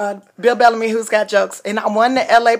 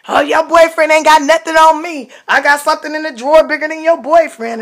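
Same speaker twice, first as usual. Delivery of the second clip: retold heckling yell; 6 semitones higher, 5 dB louder than usual. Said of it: "they start yelling out".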